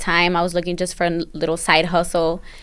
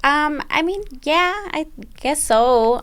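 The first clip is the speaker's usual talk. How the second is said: hesitant, drawn-out, higher-pitched answer; unsure; 8 semitones higher, slower than usual. Real, not acted. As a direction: say it tentatively, lacking confidence. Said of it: "Gotta say that with a little confidence".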